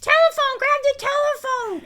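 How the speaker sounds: high, loud holler across the house; hammed-up high-pitched shout for the phone; imitated yell. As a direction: yell it out shrilly like someone interrupting. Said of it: "someone yells".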